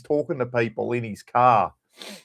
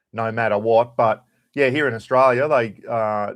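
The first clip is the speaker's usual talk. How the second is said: normal talking voice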